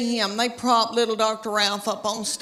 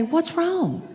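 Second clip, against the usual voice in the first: husband's innocent question; 6 semitones higher than usual. Retold or imitated. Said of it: "And he would say".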